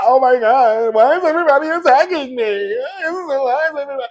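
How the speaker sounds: high, whiny mock victim voice; hammed-up whiny complaint. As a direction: play the wronged girl, whining.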